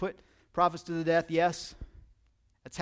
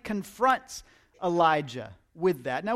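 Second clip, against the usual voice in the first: normal talking voice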